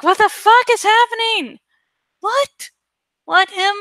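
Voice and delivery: high shocked disbelief; shocked fandom. Retold or imitated. Fans reacting to the reveal, a high shocked outcry. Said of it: "It'd be like".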